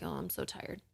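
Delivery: soft, offhand murmur of tiredness; a quiet passing thought of being tired; hushed, mild mock weariness; casual tired thought. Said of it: "We just have a casual thought like".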